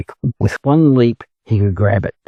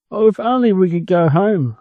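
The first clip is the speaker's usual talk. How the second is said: higher, pleading cry of little ducklings; 9 semitones higher than usual. Acted. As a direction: wail it, homesick and sorry. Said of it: "they cried".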